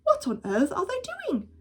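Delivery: high, fast baffled question; puzzled outcry. Acted. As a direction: blurt it out high and quick. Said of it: "said Mister Gregg".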